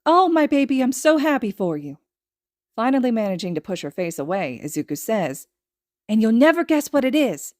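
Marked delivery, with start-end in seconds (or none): 0.0-2.0 high, loud delight; delighted mother. Acted. Mother delighted at her son's news, high and gushing. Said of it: "mother gushes". 6.1-7.5 excited teasing. Acted. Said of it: "child teasing".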